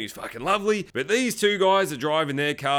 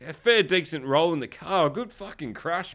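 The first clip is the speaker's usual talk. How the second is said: ordinary talk, nothing marked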